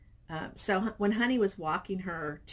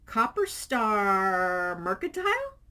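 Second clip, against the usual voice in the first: puzzled reading; 2 semitones higher, 6 dB louder, slower than usual. Real, not acted. Puzzling over a name.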